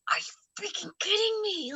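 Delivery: high, held-in mock yell; strained exasperation without full volume; pretend outburst. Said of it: "wanted to just yell and scream".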